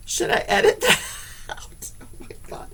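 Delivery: whispered through giggles; giggly high whisper; laughing whisper. Real, not acted. Laughing off the fright.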